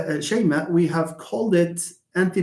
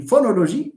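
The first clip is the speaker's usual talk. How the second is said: phonology with full, even O vowels, French-style; French-style mispronunciation; 6 semitones higher, 4 dB louder, faster than usual. Shown as a demonstration. Teacher mimics a learner's error, French-like pronunciation. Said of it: "No, that's French".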